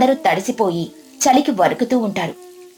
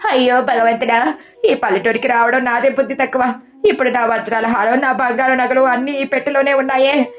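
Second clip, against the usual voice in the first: wailing; 3 semitones higher than usual. A narrator's reading. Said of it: "ఏడుస్తూ గట్టిగా అరుస్తూ".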